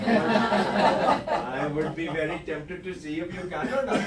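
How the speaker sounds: put-on slow Indian accent through laughter; playful Indian accent. Coach answers a challenge to his accent, playful Indian accent. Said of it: "I do the Indian accent a lot".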